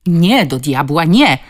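loud, exasperated denial; forceful cursing outburst; shout. A narrator's reading. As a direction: shout it, hitting each no hard. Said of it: "krzyknął".

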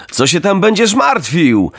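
put-on high, breezy boyish voice; cheeky boy Knot. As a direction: toss it off like a carefree kid.

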